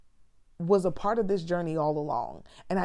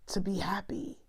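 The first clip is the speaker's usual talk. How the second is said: whispered wish; 5 dB quieter than usual. Retold or imitated. Whisper heard.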